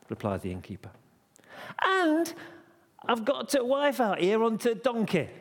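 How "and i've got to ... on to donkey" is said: high, insistent plea; indignant shout. Acted. Joseph pleading for his wife on the donkey, shouting. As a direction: press the case in a high, raised voice.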